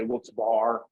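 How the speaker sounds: Wilkes-Barre ending in one syllable, "bar"; "bar" pronunciation. Shown as a demonstration. Local listing how people say it, Wilkes-Barre as "bar". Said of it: "Some people say".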